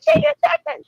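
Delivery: imitating your own hollering, voice shooting way up; retold hollering. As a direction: squeal it out as a mock holler. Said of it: "I literally started hollering".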